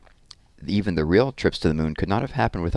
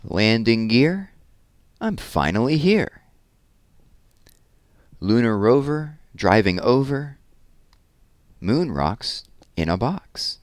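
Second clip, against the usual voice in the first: lively, sing-song read-aloud of a rhyming book; reading to children; 4 semitones higher, much slower than usual. Acted. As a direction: read it with bounce for the little ones. Reads rhyming rocket picture book.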